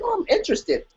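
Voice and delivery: high, soft eager little voice; mock eager. Recalling her book search in a high, soft, eager voice. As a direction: chirp it like a curious shopper.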